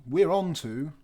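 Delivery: ordinary delivery, nothing marked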